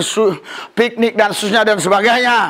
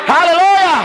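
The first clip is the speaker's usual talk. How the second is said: answering cheers with ever higher shouts; rising praise calls; 8 semitones higher than usual. Real, not acted. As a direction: call out, climbing step by step.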